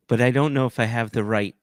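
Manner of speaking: ordinary talk, nothing marked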